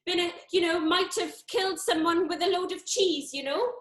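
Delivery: a lightly put-on South Wales accent; lilting South Wales Brenda, worried. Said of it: "that's 'cause I'm from South Wales".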